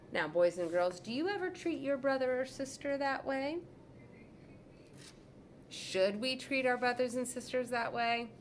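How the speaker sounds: put-on teacher pausing to quiz kids; storyteller asking listeners; reading to children. A narrator's reading. Narrator addressing children.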